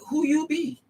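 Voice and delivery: high, put-on sassy voice; playful diva voice; sassy mock challenge. Woman imitating herself in the streets, sassy and high. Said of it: "I'll be like".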